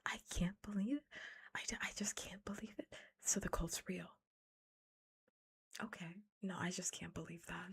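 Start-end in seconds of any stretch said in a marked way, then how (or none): none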